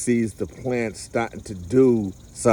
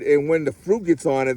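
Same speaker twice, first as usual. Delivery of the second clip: ordinary talk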